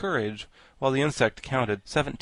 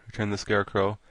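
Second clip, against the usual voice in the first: plain reading voice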